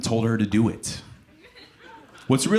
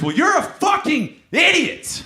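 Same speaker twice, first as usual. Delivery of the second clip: slow, much higher, loud screaming insult; furious shout; 6 dB louder, slower than usual. Retold or imitated. Date screaming at him after Moulin Rouge. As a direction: yell it out, drawn out and shrill with rage.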